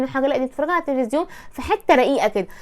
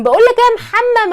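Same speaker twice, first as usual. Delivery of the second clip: loud, raised call across the house; calling out to brother; 9 semitones higher, 5 dB louder than usual. Retold or imitated. Wife mimics calling her husband like a brother, loud call. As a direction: yell for Mohamed like a sister in the hallway. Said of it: "مع جوزك".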